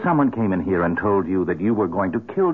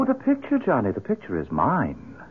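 sly taunt; 6 semitones higher than usual. Acted. Scarlett taunting Johnny with picture.

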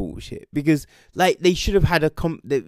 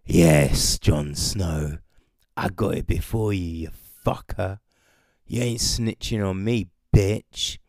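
much lower, panting taunt; panting Grey Worm; 7 semitones lower than usual. Retold or imitated. Grey Worm gloating to Jon after racing him, low panting taunt. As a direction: sneer it low and out of breath. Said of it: "Grey Worm's panting".

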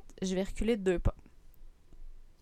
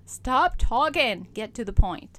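loud, slow, impatient voice; fed-up audience telling her to hurry; impatient listener; 3 semitones higher, 6 dB louder, slower than usual. Retold or imitated. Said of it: "vous êtes comme là, Tam".

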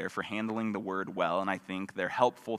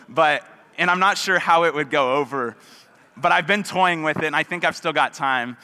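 higher and louder, laughter still in the voice; laughing while talking; 6 semitones higher, 9 dB louder than usual. Real, not acted. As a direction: keep talking through the laughter. Still laughing after the joke.

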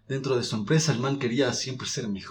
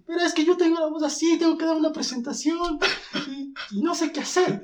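very high, pleading squeak; squeaky high voice. Retold or imitated. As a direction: explain the problem in a tiny high voice. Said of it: "Le dice". (voz muy aguda, suplicante)

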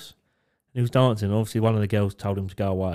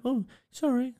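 mock timid lads; 12 semitones higher, 4 dB quieter than usual. Mates voiced, high.

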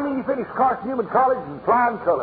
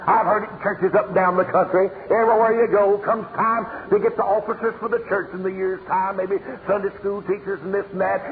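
ordinary talk, nothing marked

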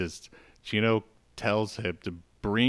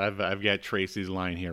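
normal talking voice

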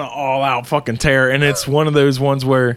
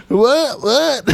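taunting; 12 semitones higher than usual. Pretend. Taunting the dog.

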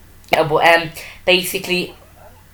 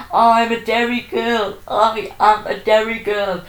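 slow, higher mock shout of the line; 6 semitones higher, slower than usual. James shouting to the crowd, a mock slow shout. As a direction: belt it out like James at the end, lightly. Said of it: "James shouting".

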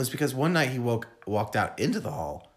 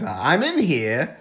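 put-on slow, loud comedian's accent; high, drawn-out imitated accent; John Mulaney; 4 semitones higher, 5 dB louder, much slower than usual. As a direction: stretch it out in a stand-up's accent. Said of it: "This is a John Mulaney thing".

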